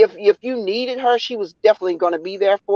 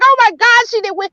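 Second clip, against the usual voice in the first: higher and louder, half laughing; higher, louder, half-laughing oh my God; worked-up retelling; 12 semitones higher, 3 dB louder than usual. Retold or imitated. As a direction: replay the moment she thought it was lost.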